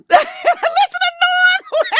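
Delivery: laughing through the words; real laughter, slowed by giggles. Real, not acted. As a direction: crack up while saying it. Laughs at Norm's reaction.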